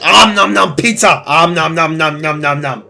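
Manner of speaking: gobble the words like a cookie monster with pizza; teasing a friend as a greedy pizza monster; pizza monster munching. Pretend. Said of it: "You were all like".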